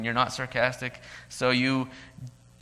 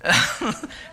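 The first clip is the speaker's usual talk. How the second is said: tearful shaky breath out; a shaky sigh as tears come; 10 semitones higher, 5 dB louder than usual. Real, not acted. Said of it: "but here it might come".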